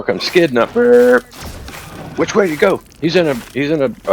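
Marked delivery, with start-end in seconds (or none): 2.2-4.1 higher, hurried mock cop call; retold urgent exchange. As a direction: act out officers calling to each other.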